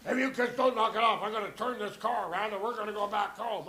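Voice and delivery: lightly voiced annoyed father threatening; dad's first threat. Dad warns bickering kids in the car, mock scolding.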